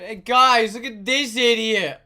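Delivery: loud, high mocking call-out; mocking shout. Coworker announcing the tired man to everyone, mocking shout. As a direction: shout it to the whole room, jeering.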